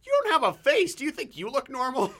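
very high, very loud mock shriek; hammed-up screech of an insult; retold movie shout. Friend acting the other guy's comeback as a loud, high mock shriek. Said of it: "And the guy goes".

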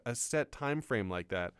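normal talking voice

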